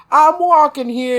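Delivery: high, loud mock New York accent; hammed-up New York holler. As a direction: imitate a brash New York pedestrian. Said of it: "We don't talk like that".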